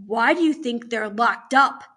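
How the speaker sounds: loud, slow pointed question; forceful warden; stern questioning. Acted. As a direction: grill the children with authority. Warden interrogates children.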